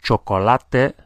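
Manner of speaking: "Chocolate" said as Spanish, cho-ko-LA-teh; Spanish pronunciation. Shown as a demonstration. Teacher models words for learners to notice, Spanish pronunciation. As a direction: give "Chocolate" four syllables, the Spanish way. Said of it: "pay attention to the pronunciation".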